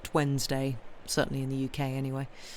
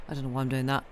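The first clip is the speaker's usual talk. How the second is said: ordinary talk, nothing marked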